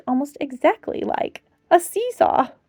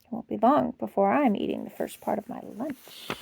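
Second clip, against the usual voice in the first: whispered; 4 semitones lower, 8 dB quieter than usual. Acted. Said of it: "he whispered to himself".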